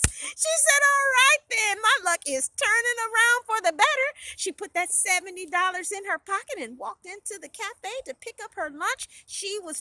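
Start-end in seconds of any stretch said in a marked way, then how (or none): 0.9-4.1 loud, high-pitched elation; triumphant. A narrator's reading. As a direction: crow it, luck finally on your side. Heroine celebrates her lucky find.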